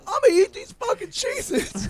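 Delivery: very high, defiant mock threat; imitating belligerent drunk. Drunk friend defies buddy over crackers, mock belligerent. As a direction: mimic a drunk declaring war over snacks. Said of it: "Zach is belligerent drunk".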